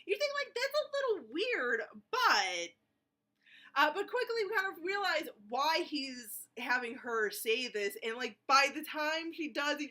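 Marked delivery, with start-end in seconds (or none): none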